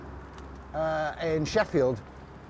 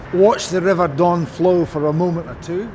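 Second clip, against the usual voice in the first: louder, measured recital; formal reading aloud; 3 semitones higher, 5 dB louder than usual. Acted. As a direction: read it out like an instruction. Reads score line.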